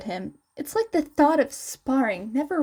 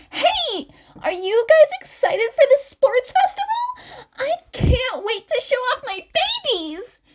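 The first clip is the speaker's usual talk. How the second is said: much higher, louder gushing; excited exclaiming; 13 semitones higher, 5 dB louder than usual. Acted. Mei gushing to friends about her inventions, high and loud. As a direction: gush about the festival. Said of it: "Mei exclaims excitedly".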